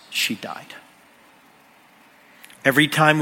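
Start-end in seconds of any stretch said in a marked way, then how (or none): 0.1-0.7 breathless whisper of the fear; hushed, frightened whisper; whispered dread. Retold or imitated. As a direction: whisper it like a terrible thought.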